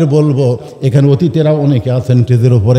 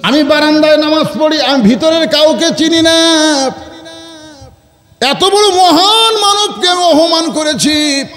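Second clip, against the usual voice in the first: high-pitched chanted outcry; shepherd crying out; 12 semitones higher than usual. Acted. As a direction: wail the confession out high. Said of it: "রাখাল চিল্লা বলতেছে".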